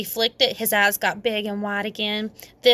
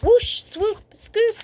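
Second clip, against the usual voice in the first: playful swoosh; 11 semitones higher, much slower than usual. A narrator's reading. Sound effect narration.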